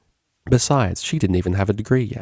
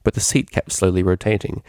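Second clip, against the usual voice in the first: plain reading voice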